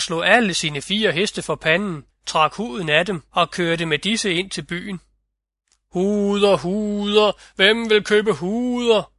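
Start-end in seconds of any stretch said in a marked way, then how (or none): 5.9-9.1 high, drawn-out street vendor's call; gaderåb. A narrator's reading. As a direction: hawk the wares down the street, stretching each word. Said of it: "råbte han gennem gaderne". (syngende, højt og langtrukkent sælgerråb)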